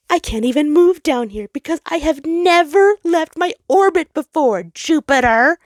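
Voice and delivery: high, loud anguished outburst; the sun crying out at Jupiter, high and loud. Acted. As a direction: pour out every grievance at once. Sun trapped and angry.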